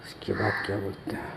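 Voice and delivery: very soft, voiceless thinking aloud; whispering to oneself. Real, not acted. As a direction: whisper it privately, as if trying to recall. खुद से सोचते हुए पूछना. (खुद से फुसफुसाते हुए)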